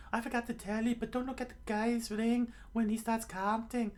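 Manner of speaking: very high falsetto warning; high put-on cartoon voice; warning companion. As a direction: pass on the warning in a squeaky voice.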